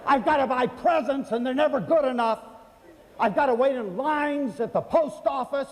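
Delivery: fed-up shopper listing her chores, mock fury; imitating furious shopper. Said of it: "Well, she was furious".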